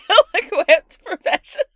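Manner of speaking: high loud laugh through words. Real, not acted. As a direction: crack up while saying it. Laughing repeat.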